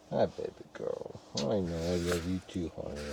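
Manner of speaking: very soft, hushed affection; tender murmur. Real, not acted. Owner to dog snuggled up at bedtime, tender murmur. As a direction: murmur it low and softly, just for the dog.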